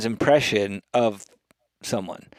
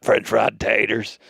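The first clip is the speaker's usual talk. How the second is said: impersonation at his usual pitch; Sling Blade. Acted. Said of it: "I once channeled Sling Blade perfectly".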